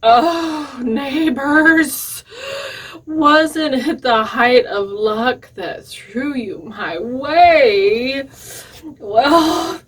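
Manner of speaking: loud, high, fluttery rooster voice; trembling rooster. A narrator's reading. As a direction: cry out as a rescued, trembling rooster. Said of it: "trembling to Jack and his comrades".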